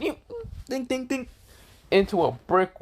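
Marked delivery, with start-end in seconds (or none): 0.0-1.3 high-pitched cartoon ricochet dings; imitated impact noises. Storyteller imitates Kakashi flying into a wall, cartoonish. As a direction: voice the sound of a body bouncing away. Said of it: "Kakashi went".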